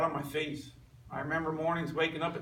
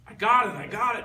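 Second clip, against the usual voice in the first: higher and louder, bursting with the news; excited; 6 semitones higher, 8 dB louder than usual. Retold or imitated. Telling friends he got the job, excited. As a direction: shout the good news like it just happened. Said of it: "and everybody's excited".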